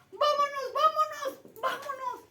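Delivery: loud, high shout of come on; an eager, rallying call to go. Acted. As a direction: yell it to pull your brother along. Brothers pulling each other along.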